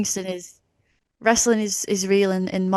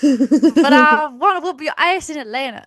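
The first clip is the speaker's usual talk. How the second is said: higher, playful American accent imitation; put-on American accent; 6 semitones higher, 4 dB louder than usual. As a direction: ham up the American wrestler accent. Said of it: "I love doing that accent".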